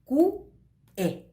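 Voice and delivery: a clear gap between "Q" and "E"; brief pause inside word. Shown as a demonstration. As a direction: say ku, stop the sound, then e for "Q E". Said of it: "hago una pequeña pausa".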